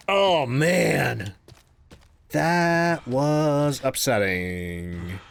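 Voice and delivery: very slow, weary; drained and slow. Real, not acted. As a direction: sigh it out. Slowed, drained.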